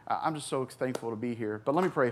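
ordinary delivery

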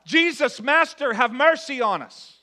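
loud, high plea for mercy; crying out. Acted. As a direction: call out to Jesus from a distance. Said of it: "they cried out".

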